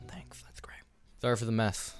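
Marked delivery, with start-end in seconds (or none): 0.1-0.8 dry whispered thanks; a breathy sarcastic aside. Real, not acted. Sarcastic aside while building.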